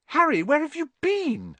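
put-on very high, loud squeak of a worried girl; a sudden squeak of relief and worry. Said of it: "Hermione squeaked".

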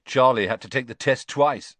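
cheeky, lifted pitch; grinning. A narrator's reading. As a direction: tease an absent brother with a grin.

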